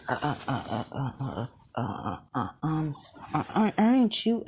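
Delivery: stuttering nervously, rising to a high squeak; scared stammer. Acted. Boy wakes beside a stranger, scared stammering. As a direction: stammer in fright before getting the question out. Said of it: "as he's scared".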